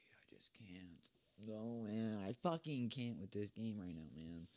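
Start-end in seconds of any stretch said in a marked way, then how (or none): 0.1-1.1 exasperated whisper. Real, not acted. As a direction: breathe it out in despair.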